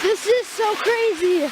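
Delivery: slow, high and amazed; awestruck. Real, not acted. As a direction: stretch it out in wonder. Awestruck at waterfall, drawn out.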